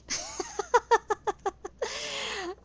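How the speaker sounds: long, high, giggly laugh; helpless high-pitched tittering; amused laughter. Real, not acted. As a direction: giggle softly and keep it rolling. Laughs at the story's punchline.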